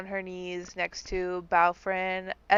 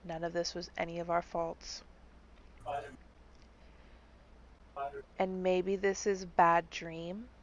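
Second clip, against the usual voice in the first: tender consoling; 7 dB quieter than usual. Acted. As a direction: console her gently, like a grieving friend. Bard consoles the grieving girl.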